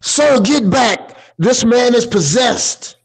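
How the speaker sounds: urgent in-character exclamation; exclaiming in alarm. Acted. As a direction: cry out as if denouncing a possessed man. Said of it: "she'll exclaim".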